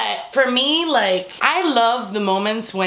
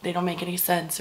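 softer, lower mock aside; murmured onlooker remark; 4 semitones lower, 9 dB quieter than usual. Onlookers judging the couple, murmured. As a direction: mutter it like a doubting onlooker.

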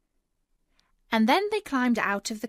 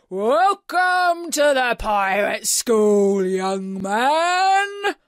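slow, loud pirate captain's welcome; booming; 3 semitones higher, 8 dB louder, much slower than usual. A narrator's reading. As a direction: boom it out grandly, stretching the words. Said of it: "he boomed".

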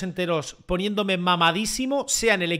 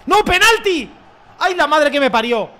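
high, loud cry of despair; real dismayed yell; dismayed shout; 8 semitones higher, 8 dB louder than usual. Real, not acted. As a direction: wail it in disbelief. Penalty given against him.

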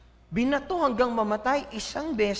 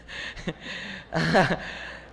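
low laugh; amused low laughter at one's own line; 6 semitones lower than usual. Real, not acted. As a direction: let out a real chuckle. Laughs after his own line.

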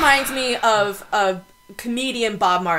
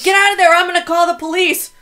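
high, louder mock yell; mock drunk yelling; 7 semitones higher, 5 dB louder than usual. Drunk adult yelling at the play-place kid, mock yell. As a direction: shout back like a belligerent drunk. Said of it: "they're like".

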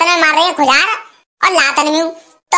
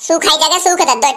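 normal talking voice

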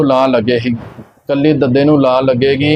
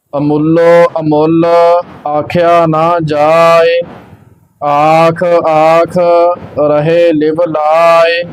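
slow, measured sacred recitation; scripture recitation; slower than usual. Acted. Teacher reciting Gurbani for learners, slow measured chant-like reading. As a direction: recite evenly, drawing out the line endings.

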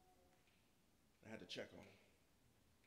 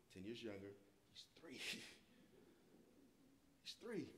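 ordinary talk

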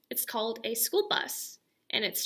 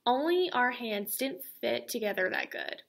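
plain reading voice

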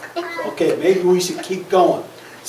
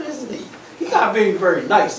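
plain reading voice

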